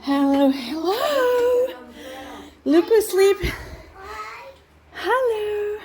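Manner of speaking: high, slow, loving hello. Real, not acted. Greeting her children in the shelter.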